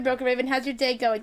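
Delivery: normal talking voice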